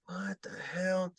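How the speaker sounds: slow muttered puzzlement. Retold or imitated. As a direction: slowly puzzle over the footage. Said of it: "And I'm like".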